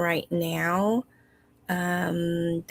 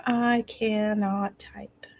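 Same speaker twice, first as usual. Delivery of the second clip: hushed aside to herself while typing; a private whisper while fumbling with the phone; 15 dB quieter than usual. Real, not acted. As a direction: murmur it under your breath, unvoiced.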